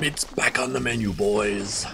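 loud, hammed-up orc voice; rally the orc troops loudly; big mock-monster shout; orc battle cry. Quotes film orc line in game.

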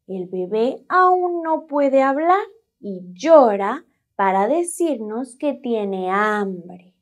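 normal talking voice

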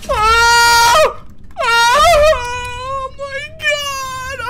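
piercing high shriek of real fright; shrieking in panic; terrified scream. Real, not acted. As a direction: scream at the jump scare. Jump scare in horror game.

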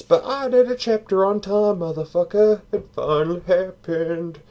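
high-pitched celebration, voice lifted well up; high, elated boast; triumphant. Real, not acted. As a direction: crow about it in a high voice. Celebrates finishing the chapter on time.

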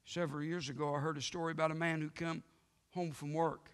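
ordinary talk

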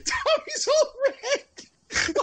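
high, breathless laughing fit; helpless laughter. Real, not acted. Podcast host cracks up at a listener's odd name, laughing helplessly. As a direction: squeeze the words out through uncontrollable laughter.

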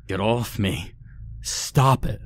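a restrained, narrated scream of horror; horrified scream. A narrator's reading. Captive fending off the biting man, horrified scream held back. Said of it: "I screamed, horrified".

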